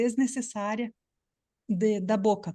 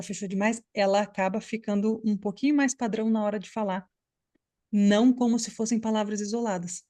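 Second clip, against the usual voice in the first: normal talking voice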